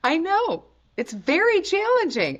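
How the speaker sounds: high, bright teasing glee; delighted mock sympathy; gleeful teasing. Real, not acted. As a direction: gloat playfully about the hard question. Teasing about a hard choice.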